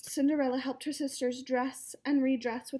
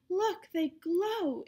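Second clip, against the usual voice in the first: higher, very slow words of wonder; awed exclamation; 6 semitones higher, much slower than usual. A narrator's reading. Cinderella shows her glowing slippers, slow and amazed. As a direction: marvel at it, drawn out and lifted. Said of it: "exclaimed Cinderella".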